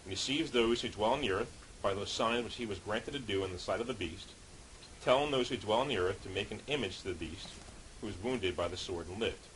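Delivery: ordinary talk, nothing marked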